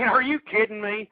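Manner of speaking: usual speaking voice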